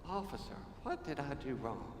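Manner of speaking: mock polite driver. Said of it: "rolled down the window and said".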